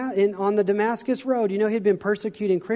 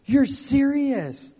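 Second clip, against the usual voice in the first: stunned disbelief; 7 semitones higher, much slower than usual. Retold or imitated. Realizing the dream's meaning.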